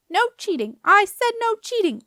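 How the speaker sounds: high, loud, building screech; squeaky doorkeeper shrieking the rule; excited scream. A narrator's reading. Doorkeeper catching the girl with the map, screaming. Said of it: "the doorkeeper screamed excitedly".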